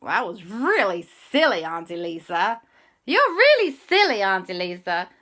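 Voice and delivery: mocking playground chant; teasing kids. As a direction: tease her like cheeky children. Kids teasing aunt in chorus.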